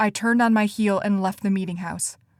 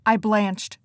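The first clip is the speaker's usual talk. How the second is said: plain reading voice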